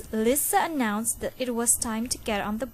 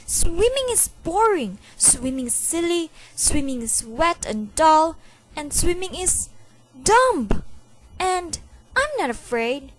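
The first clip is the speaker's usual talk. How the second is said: worked-up rant; 7 semitones higher, 6 dB louder than usual. A narrator's reading. Boy working himself up alone.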